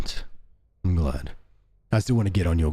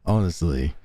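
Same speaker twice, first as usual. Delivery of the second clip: ordinary talk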